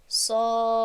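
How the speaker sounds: صاخة recited loud, the alif held for six counts; six-count madd. Shown as a demonstration. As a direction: draw out the long a in صاخة in tajweed style. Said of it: "باید به شش حرکت دراز کنیم".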